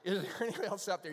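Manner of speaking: fast, loud repeat of a punchline; quick punchline recap. Retold or imitated. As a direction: rattle it off quickly, still projected. Repeats punchline to explain joke.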